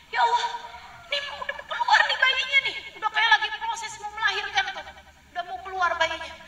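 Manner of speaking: high, urgent cry of a woman in labor; panicked Maryam. Retold or imitated. (Maryam panik menjelang melahirkan, suara tinggi)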